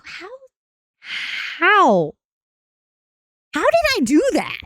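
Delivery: high incredulous how; mock bewildered question; baffled disbelief. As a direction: squeak out your confusion. Retold baffled self.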